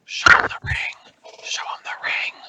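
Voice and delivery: urgent hushed whisper. Acted. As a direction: whisper it under your breath. Urging whisper to companion.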